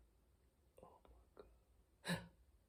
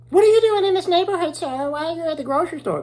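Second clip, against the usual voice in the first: suspicious neighbor; 9 semitones higher, 4 dB louder, faster than usual. Retold or imitated. Acting suspicious stranger.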